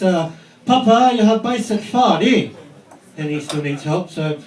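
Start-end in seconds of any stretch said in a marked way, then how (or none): 0.6-2.5 higher, lightly voiced small boy; imitating little son. Six-year-old Isaac calling his dad, lightly voiced higher child. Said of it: "He says".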